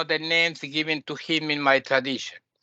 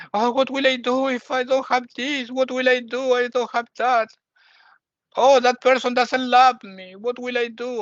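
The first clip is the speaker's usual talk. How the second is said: higher, pleading voice of a worrier; mock anxious fretting; 6 semitones higher than usual. Teacher imitating an ignorant person afraid of losing things, higher fretful voice. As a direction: whine like someone clinging to what they have.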